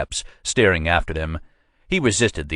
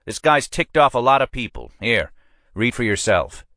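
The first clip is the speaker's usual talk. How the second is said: higher-pitched, pushy precinct captain; a captain pressing his man, voiced higher than the narrator; police captain; 7 semitones higher than usual. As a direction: lean on your sergeant like a boss who wants results. Captain hands over file.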